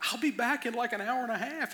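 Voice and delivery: lightly acted promise to a worried child; reassuring dad. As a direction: lift it up to reassure her. Dad answers daughter's dramatic goodbye.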